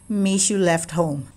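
plain reading voice

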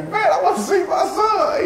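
high mock yell; movie character yelling. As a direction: cry out like the desperate dad in the film. Retelling the movie scene.

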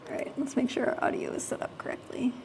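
quick muttered whisper; whispering to himself. Real, not acted. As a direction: whisper it under your breath. Muttering to himself during setup.

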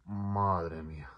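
slow, drawn-out pained words. Retold or imitated. Trying to stand up on a torn calf, slow and pained. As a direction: let the pain stretch it out slowly.

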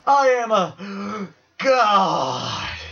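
slow, high, breathy moaning; orgasmic moan. Pretend. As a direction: moan it out, overdone. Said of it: "Sound like you just orgasmed it".

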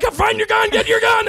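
high, loud urgent command; urgent shout. Retold or imitated. Improv partner shouts orders at the actor. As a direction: bark the order at full volume. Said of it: "And he's like".

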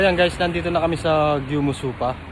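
ordinary talk, nothing marked

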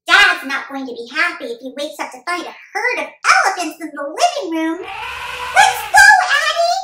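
put-on high, loud excited kid voice; urgent little boy on an elephant hunt; excited young boy.

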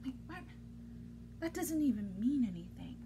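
soft, baffled aside; confused aside. Acted. Reader stunned by the silly word, hushed confusion. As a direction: feign confusion under your breath.

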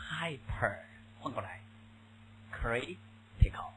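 teacher splitting the word quietly; split into parts. Shown as a demonstration. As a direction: break hyper and critical apart slowly. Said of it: "这个词注意一下啊，hyper".

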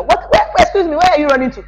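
high-pitched incredulous questioning; acted disbelief. Asking parents in Nigeria where they are running, mock disbelief. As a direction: pitch it up as if stopping someone rushing past.

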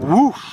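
loud, high whoosh of fire; imitated whoosh. Reader imitating the dragon blowing its nose, loud whoosh. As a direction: make a big blast noise.

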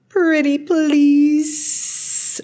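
very slow, high, wheedling hiss; pleading snake. A narrator's reading. Snake begs the girl for shelter, hissing. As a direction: stretch it right out into a long hissed s. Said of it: "repeated the voice".

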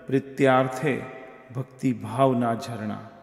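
slow, measured verse with drawn-out words; poetry recitation. Acted. Host reciting a tribute muktak for the poet, slow poetic recitation.

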